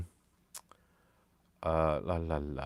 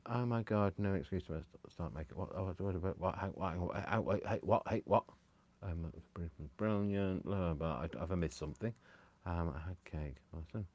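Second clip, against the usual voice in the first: mumbled reading; 9 dB quieter than usual. Real, not acted. Mumbling while reading chat.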